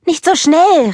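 high, loud anxious cry; worried call. Acted. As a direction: warn your sister before she falls. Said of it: "rief Elsa besorgt". (besorgt und laut rufend)

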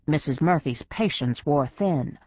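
ordinary delivery

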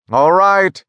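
shouted. A narrator's reading. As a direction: shout back your agreement. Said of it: "shouted Zager".

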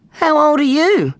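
high, slow innocent question; lightly voiced boy. Snag asks a gladiator nearby, curious and high. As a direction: ask like a curious small boy.

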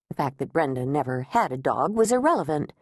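ordinary delivery

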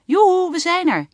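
high, loud yoo-hoo over the machines; bright loud hello call; cheerful calling out. A narrator's reading. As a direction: sing out to announce arrival. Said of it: "riep Lieve".

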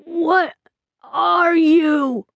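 high, shocked and frightened cry; terrified. Acted. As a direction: blurt it out in horror. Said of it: "his eyes wide with shock and terror".

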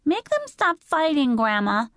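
put-on very high, pleading little girl; little Dory's small voice. A narrator's reading. Little sister begging Grandma to end fighting, small high plea. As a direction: beg in a small child's voice. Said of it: "Dory's small voice asked".

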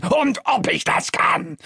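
father's high, forceful roar; bellowing. A narrator's reading. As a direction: bellow it back defiantly. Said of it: "brüllt mein Vater".

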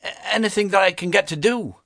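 put-on high, simple boy's answer; earnest poor boy. A narrator's reading. Boy answers the stern master, lightly voiced earnest boy.

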